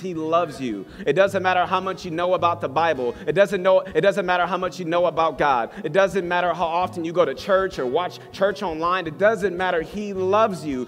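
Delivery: urgent, driving exhortation; impassioned preaching. Real, not acted. Pastor urging praying congregation to hold on, impassioned preaching. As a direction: press each promise home with conviction.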